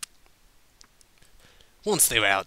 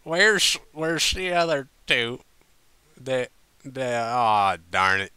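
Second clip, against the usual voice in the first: louder, slurred and bewildered; drunken confusion; 3 semitones higher, 6 dB louder than usual. A narrator's reading. Applejack confused and drunk asking about friends, slurred. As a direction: fumble the words like a tipsy pony. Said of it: "Applejack said suddenly".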